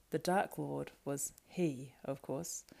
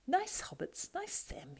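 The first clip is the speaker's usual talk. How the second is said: high wheedling creature voice; fawning Gollum; 12 semitones higher, slower than usual. Acted. Gollum fawning over the waking hobbits. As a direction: flatter them slyly.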